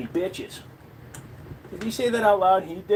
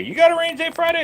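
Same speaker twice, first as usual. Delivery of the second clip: much higher, quick echo; mock amazement; 12 semitones higher, faster than usual. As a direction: repeat it back high and fast in mock amazement. Echoes viewer comment in mock excitement.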